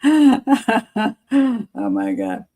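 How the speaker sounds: drawn-out, laughing oh my God; flustered laugh. Real, not acted. Blushing at a viewer's compliment, laughing it out. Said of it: "I'm blushing".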